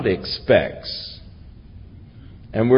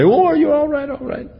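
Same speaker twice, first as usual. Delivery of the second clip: mock panicked parent, much higher; panicky parent; faster than usual. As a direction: imitate a frightened parent rushing over. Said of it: "get all excited and scream and run over and say".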